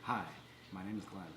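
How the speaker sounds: soft, barely audible hello; too quiet. Real, not acted. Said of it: "You gotta talk a little louder".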